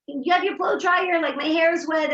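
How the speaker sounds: high, loud call to a sister; mock yell from upstairs. Daughter calling down the stairs for her blow dryer, loud and high. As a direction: shout down the stairs for it. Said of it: "the one upstairs is yelling".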